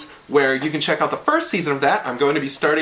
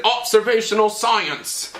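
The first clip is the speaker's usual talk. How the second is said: high, bad Australian accent on this phrase; 7 semitones higher than usual. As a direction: lay on a clumsy Australian accent. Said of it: "my really terrible Australian".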